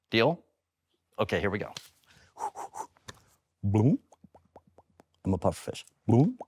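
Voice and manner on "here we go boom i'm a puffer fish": usual speaking voice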